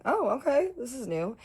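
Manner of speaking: surprised. Retold or imitated. Surprised at being spooned.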